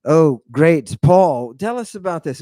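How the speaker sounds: usual speaking voice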